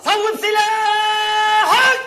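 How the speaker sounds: slow, drawn-out, sung shout of صوب سلاحك; shouted command. Acted. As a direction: bellow the order, stretching every syllable. Soldier ordering to aim.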